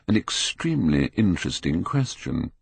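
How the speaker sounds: plain reading voice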